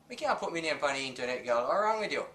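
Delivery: usual speaking voice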